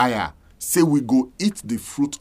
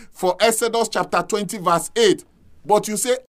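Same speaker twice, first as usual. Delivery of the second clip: higher-pitched, insistent preacher voice; impassioned preaching; 8 semitones higher than usual. Real, not acted. Preacher telling listeners the Sabbath is clear, impassioned. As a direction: preach it high and urgent. Preaching rises to full force.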